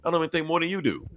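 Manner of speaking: high, fast panicked denial; mock frantic Lex Luthor denial; Luthor protesting. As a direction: pitch it up and rush the excuse.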